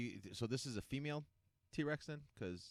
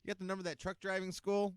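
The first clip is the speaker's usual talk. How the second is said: high-pitched call over to a coworker; comically fed up, voice pitched up; mock fed-up call; 8 semitones higher than usual. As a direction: call out in playful exasperation. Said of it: "whenever we get really pissed off at work".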